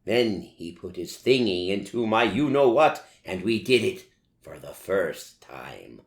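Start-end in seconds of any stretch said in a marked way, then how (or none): none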